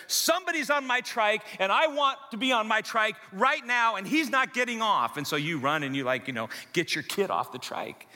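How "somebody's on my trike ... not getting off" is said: put-on higher, wailing little-kid complaint; upset toddler. Little boy freaking out about his trike, higher and wailing. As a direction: whine like a child whose trike was taken. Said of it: "he's freaking out and losing his mind".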